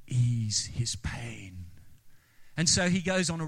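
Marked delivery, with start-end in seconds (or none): 0.1-1.7 slow, eerie whisper; drawn-out ghostly whisper. Retold or imitated. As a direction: breathe it out slowly like a ghost. The voice speaks again.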